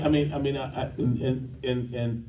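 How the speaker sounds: normal talking voice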